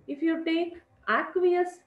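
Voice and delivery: "aqueous" lifted high and drawn out slowly; stress on aqueous. Shown as a demonstration. Teacher to students, stressing the contrasting reagent. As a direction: hit "aqueous" to contrast it with dry. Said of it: "why I am stressing".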